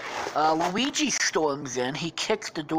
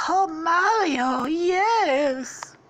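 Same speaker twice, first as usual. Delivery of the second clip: high, slow, loud falsetto gush; swooning princess; 13 semitones higher, 6 dB louder, much slower than usual. Acted. Princess accepting the proposal, swooning falsetto.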